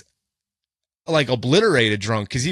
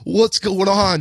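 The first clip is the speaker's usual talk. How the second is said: higher-pitched imitation of a giggly drunk; mock clueless, raised-pitch voice; giggly and oblivious; 7 semitones higher, much slower than usual. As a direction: play dumb, as if tipsy and amused. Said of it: "Just, like, giggling".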